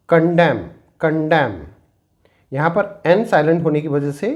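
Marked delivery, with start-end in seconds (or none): 0.1-1.6 कंडेम said as condem, no n; silent n demo. Shown as a demonstration. Teacher showing students the silent n in कंडेम. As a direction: end कंडेम on m. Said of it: "इसे हम पढ़ेंगे".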